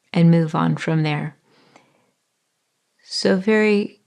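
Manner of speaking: calm body-scan voice with long pauses; guided meditation. Acted. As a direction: ease the listener into their body, unhurried.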